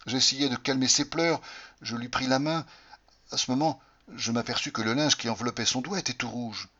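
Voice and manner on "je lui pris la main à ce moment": ordinary delivery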